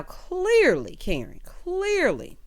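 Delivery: "clearly" stretched out very slowly; long, drawn-out "clearly", pointed at Karen; exasperated emphasis. Real, not acted. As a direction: drag out each "clearly" for emphasis.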